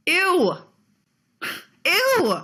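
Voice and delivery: loud, high, real "ew" of disgust. Real, not acted. Ranting about her ex, a sharp high disgusted ew. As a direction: recoil in open disgust.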